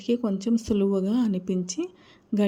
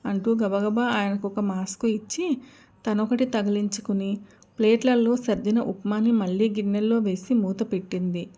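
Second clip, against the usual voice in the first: ordinary delivery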